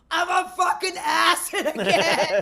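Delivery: loud, high, drawn-out freak-out; mock panicked shout. Driving as the freeway melts, mock panicked shout. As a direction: holler it slowly in comic horror.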